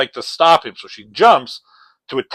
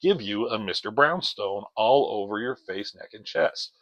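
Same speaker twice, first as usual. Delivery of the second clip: softer, low menacing threat; villain on the phone, softer and menacing; 4 semitones lower, 7 dB quieter than usual. Retold or imitated. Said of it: "He says".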